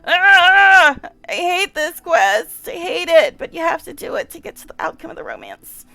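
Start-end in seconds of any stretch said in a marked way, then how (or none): 0.0-1.0 short loud high-pitched wail of distress; anguished cry. Real, not acted. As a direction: let out a pained yelp. Cries out as the scene begins.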